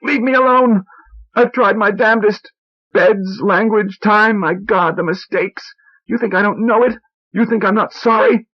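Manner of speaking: high, loud frantic shouting; hysterical yelling. A narrator's reading. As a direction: pour it all out at the top of the voice. Wife's hysterical outburst.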